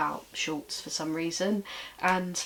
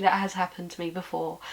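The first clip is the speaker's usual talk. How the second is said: ordinary talk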